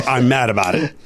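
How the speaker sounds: ordinary delivery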